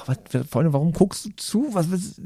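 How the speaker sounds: high, soft bewildered cat. Acted. Comedian voicing a cat who stares at the man in bed, high and baffled. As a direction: play a confused cat staring up at you.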